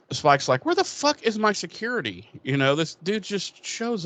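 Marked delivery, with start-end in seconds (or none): 0.6-2.3 annoyed outburst. Retold or imitated. Spike annoyed at intruder.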